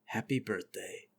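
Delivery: slow, very soft, low voice; nude nano man. Acted. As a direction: say it hushed and serene, low. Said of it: "He opens his eyes and says".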